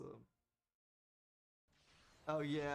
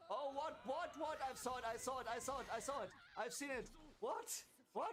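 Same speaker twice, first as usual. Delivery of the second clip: frantic high-pitched disbelief; shocked high yelps; 10 semitones higher, 4 dB quieter than usual. Real, not acted. Gamer yelping at the screen in shock. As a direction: voice jumps up in panicked surprise. Frantic reaction to game move.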